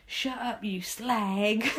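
light Cockney cat voice, lifted; a hint of Cockney, rude cat; Cockney accent. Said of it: "a Cockney accent".

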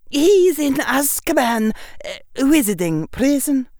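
high clipped schoolmistress; stern old witch explaining. Acted. McGonagall voiced.

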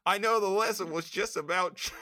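put-on high Australian accent, slightly laughing; Aussie whine, keep it cartoonish; Australian [name]. Cohost says keep going, high Australian accent. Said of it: "say it in an Australian accent".

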